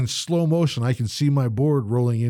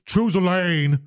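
mild mock yell, pitched up; higher-pitched imitation of a yell; retold yell; 6 semitones higher, slower than usual. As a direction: imitate a cyclist calling out. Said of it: "that yelled".

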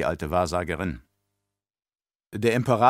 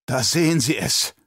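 gasped out in horror; horrified gasp; 4 semitones higher than usual. Acted. Baron gasps to the emperor, horrified. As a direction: breathe it out aghast. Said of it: "keuchte der Baron entsetzt".

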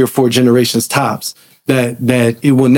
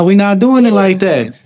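ordinary talk

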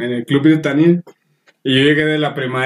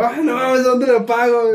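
high-pitched mock panic; retold panic; 7 semitones higher, slower than usual. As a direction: blurt it out in comic alarm, pitched high. Retold panic at spattering oil.

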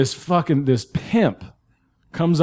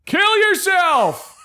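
heckler yell; much slower than usual. Retold or imitated. Heckler yelling again.

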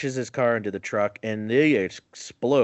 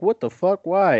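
higher, louder mock shock; shocked airmen; 8 semitones higher, 5 dB louder than usual. Airmen react to being told to flee, shocked. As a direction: blurt it out, alarmed and confused. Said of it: "And they're like".

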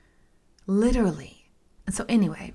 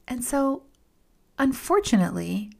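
usual speaking voice

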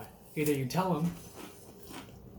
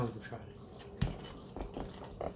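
whispered; 4 semitones lower, 11 dB quieter, much slower than usual. Real, not acted. Teasing aside to co-host.

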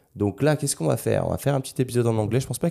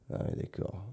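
whispered to himself; 12 dB quieter than usual. Real, not acted. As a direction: breathe it, no voice. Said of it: "whispered first take".

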